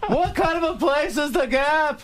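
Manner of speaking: high, slow, laughing Seinfeld; Seinfeld impression. Comic doing Seinfeld's Gap bit, high and slow. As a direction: stretch it out like Seinfeld's whiny questions. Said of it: "did Seinfeld".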